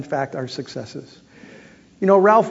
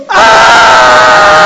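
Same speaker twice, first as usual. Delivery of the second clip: loud high shriek of fright; startled scream; 12 dB louder than usual. Retold or imitated. Host jumping away from the flaming book, loud high shriek. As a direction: shriek as the fireball goes whoosh.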